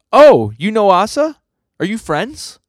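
high, drawn-out "oh" of interest; delighted surprise. Acted. Barum perks up when Denji mentions Asa, eagerly surprised. Character perks up at Asa's name.

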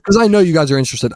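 mock-repeating his cohost; teasing echo. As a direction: throw his own words back at him, laughing.

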